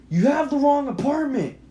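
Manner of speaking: high, loud, insistent shout; acted exasperated shout; shouted reply. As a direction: yell back through a closed door. Shouting back through the door.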